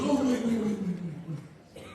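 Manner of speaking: a panicked little son, laughing; flustered kid. Retold or imitated. Son flustered, robe open.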